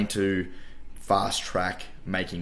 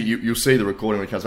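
normal talking voice

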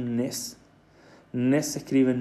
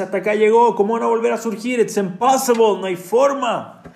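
higher, louder cry that all is lost; hammed-up despair, high and loud; 7 semitones higher, 6 dB louder than usual. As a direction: cry out that there is no way back.

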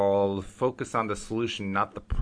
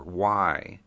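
usual speaking voice

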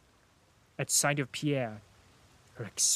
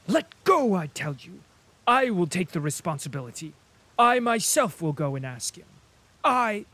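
commanding; 4 semitones higher, 7 dB louder than usual. A narrator's reading. Prince orders women to let go.

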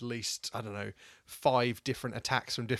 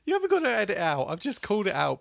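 loud protest; 9 semitones higher, 6 dB louder than usual. Real, not acted. Protesting back at the telling off.

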